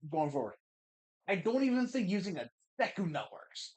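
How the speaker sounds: ordinary talk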